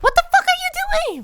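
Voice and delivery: mock shriek, very high; panicked girl. Retelling the car scene, a high mock shriek. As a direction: squeal it like the panicked heroine. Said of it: "the girl's like".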